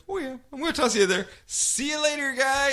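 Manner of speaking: high, fast, mocking taunt; high sneering goodbye; mocking villain voice. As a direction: taunt him in a high put-on voice. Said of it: "And she's like".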